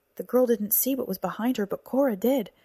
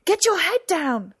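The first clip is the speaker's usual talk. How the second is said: high, loud, drawn-out warning; full-voiced yell of warning; urgent shout; 10 semitones higher, 9 dB louder, slower than usual. A narrator's reading. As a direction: shout it out to save someone. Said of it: "she managed to shout".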